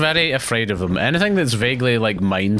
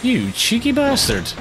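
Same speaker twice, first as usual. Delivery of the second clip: drawn-out, much higher mock indignation; mock outrage; 11 semitones higher than usual. Real, not acted. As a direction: stretch it out, playfully scandalised. Shot by a dancing enemy.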